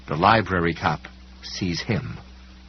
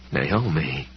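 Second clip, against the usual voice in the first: harsh, choked; 4 semitones lower than usual. A narrator's reading. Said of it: "he said in a harsh, choked voice".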